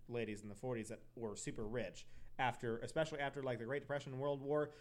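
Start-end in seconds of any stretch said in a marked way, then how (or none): none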